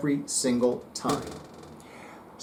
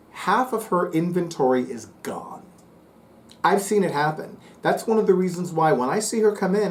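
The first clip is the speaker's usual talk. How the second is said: usual speaking voice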